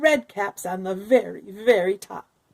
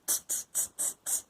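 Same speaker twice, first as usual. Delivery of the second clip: soft, breathy tutting; monkey tsk-tsk; 13 dB quieter than usual. A narrator's reading. Monkeys tutting back at the peddler, teasing. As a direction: tut back at him like a cheeky monkey.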